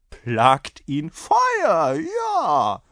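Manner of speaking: loud, high, drawn-out exclamation; heated exchange. A narrator's reading. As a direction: stretch it out, climbing very high. Incredulous host, insistent rider. (laut, gedehnt und immer höher)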